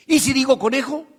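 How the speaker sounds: high, loud questioning lift; eager prompting. Retold or imitated. Young psychologist leading word association with a student, eagerly prompting. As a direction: imitate an eager prompt, rising at the end.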